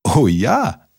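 pleased, swooping agreement; glad exclamation. A narrator's reading. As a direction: call out an eager welcome. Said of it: "rief der Maulwurf".

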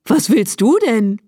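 mildly surprised question; calling out. A narrator's reading. Farmer's wife questions the donkey at her door, calling out. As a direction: ask brightly with a lifted voice. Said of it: "rief sie".

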